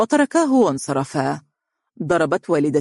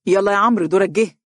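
raised voice calling his friend up; 4 semitones higher, 4 dB louder, faster than usual. A narrator's reading. Bilal calling out to Amr to take his turn up. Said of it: "هاتفا".

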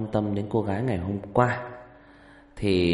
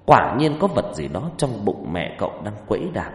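story narration; 4 dB louder than usual. A narrator's reading.

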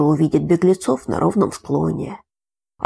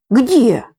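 alarmed; 6 semitones higher, 6 dB louder than usual. A narrator's reading. Grandfather startled by news.

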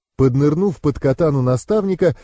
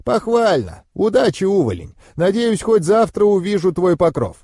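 higher-pitched, mildly amused approval; mentor's understated approving farewell; 6 semitones higher than usual. A narrator's reading. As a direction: commend the pupil with a light edge. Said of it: "одобрительно хмыкнув".

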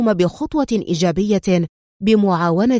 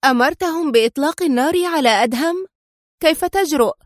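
pitched higher, rising in outrage; outraged cry; 6 semitones higher than usual. A narrator's reading. Sonia to Grusho, outraged he ordered the shooting, high angry cry. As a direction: hurl it back at him, stunned and furious. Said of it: "احتقن وجه سونيا غضبا وهتفت".

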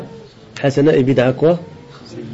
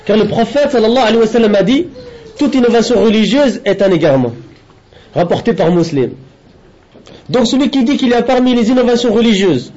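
higher-pitched, measured read-aloud in French; deliberate lesson-reading voice, raised in pitch; formal translation reading; 5 semitones higher than usual. Acted. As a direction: read out a translated religious text, phrase by phrase. Reads out French translation of text.

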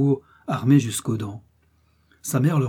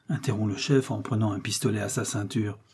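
plain reading voice

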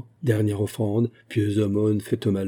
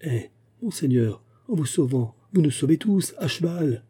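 urgent plea; 6 semitones higher than usual. A narrator's reading. Pleading with Fouquet to flee.

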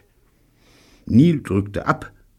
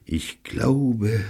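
slight sigh into the words; resigned opening; 3 semitones higher than usual. A narrator's reading. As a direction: sigh it out wearily. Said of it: "Robert seufzte".

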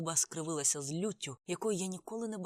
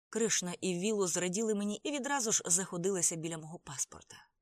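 usual speaking voice

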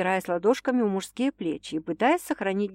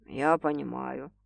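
soft understanding; 6 semitones lower, 6 dB quieter, slower than usual. A narrator's reading. Quiet apology.

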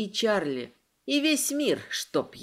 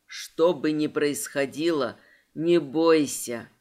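low, slow dragon reassurance; a deep dragon, firmly telling her not to fear; 7 semitones lower than usual. A narrator's reading. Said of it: "Его голос звучит в голове". (низкий, медленный голос дракона)

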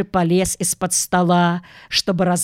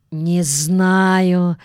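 plain reading voice

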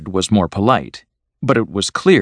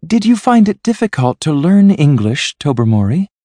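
high-pitched, careful politeness; polite; 9 semitones higher, 5 dB louder than usual. A narrator's reading. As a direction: ask brightly and courteously, pitched up high. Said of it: "Miss Resker asked politely".

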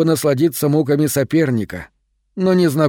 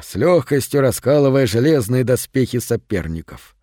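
plain reading voice

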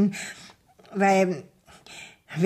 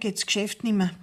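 ordinary talk, nothing marked

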